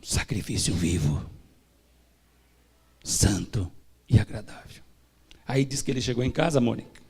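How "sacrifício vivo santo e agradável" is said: lower, whispered; hushed reverence. Acted. Preacher closing the point, whispered and low. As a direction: soften into reverence.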